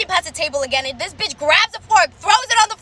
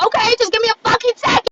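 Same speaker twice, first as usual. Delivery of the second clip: sarcastic snap; 5 semitones higher than usual. Retold or imitated. Snapping at rude customers.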